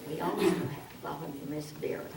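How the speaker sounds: very soft, hurried mumble; no volume. Shown as a demonstration. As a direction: introduce it almost inaudibly. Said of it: "Vera has no volume".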